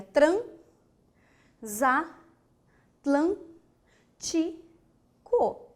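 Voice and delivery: "transatlântico" very slowly as tran, za, tlân, ti, co; syllable by syllable. Shown as a demonstration. Teacher giving the correct separation, very slow detached syllables. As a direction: pause after each syllable of "transatlântico", the s opening "za". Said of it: "qual é a correta".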